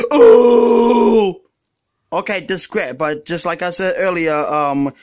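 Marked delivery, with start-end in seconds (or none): 0.1-1.4 loud far groaning oh; trademark oh. Acted. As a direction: burst out a booming oh. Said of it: "my trademark noise".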